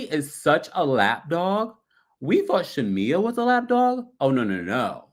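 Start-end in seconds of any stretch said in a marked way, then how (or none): none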